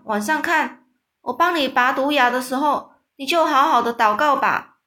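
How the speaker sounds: friendly giant ordering the coward to shut his eyes and pray; loud bossy instructions. Acted. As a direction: order him about with mock gravity. Giant bossing the scared giant.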